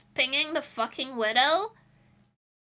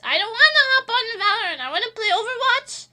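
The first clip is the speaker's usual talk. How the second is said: higher, louder exasperated whine; frustrated rant; 4 semitones higher, 6 dB louder than usual. Real, not acted. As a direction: blow off steam, pleading loud. Venting frustration about teammates.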